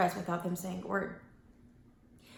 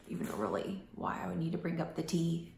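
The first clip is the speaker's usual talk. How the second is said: whisper; 2 semitones lower, 6 dB quieter than usual. Real, not acted. Aside to herself.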